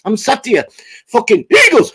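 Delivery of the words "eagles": eagles shout. Retold or imitated. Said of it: "I'm actually doing the fucking movements".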